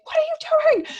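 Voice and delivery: sharp high exasperated yell at a misbehaving son; exasperated parent. Retold or imitated. Mother to her son, mock exasperated yell. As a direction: snap at the five-year-old, pitch shooting up. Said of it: "And I'm like".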